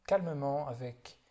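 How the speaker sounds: calm, unhurried body-scan guidance with long silences; guided meditation. Real, not acted. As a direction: guide them gently through the body, leaving space after each phrase. (méditation guidée, voix calme et posée)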